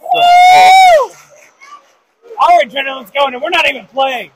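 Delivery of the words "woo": loud, high whoop; high cheering whoop. Real, not acted. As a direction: let out a big woo. Cheering at a great play.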